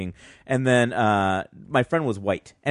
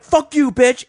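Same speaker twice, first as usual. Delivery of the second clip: high, loud insult; mock angry yell; 13 semitones higher, 7 dB louder, slower than usual. Storyteller voicing the woman swinging at him, yelling. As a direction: spit it out like a drunk brawler.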